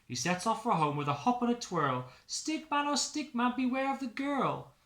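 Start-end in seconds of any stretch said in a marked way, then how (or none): 2.3-4.7 high, loud sing-song warning; warning refrain. Acted. Narrator warns Stickman of danger, high sing-song call.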